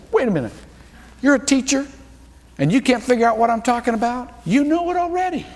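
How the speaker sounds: Jesus needling a teacher who should know; Jesus chiding. Acted.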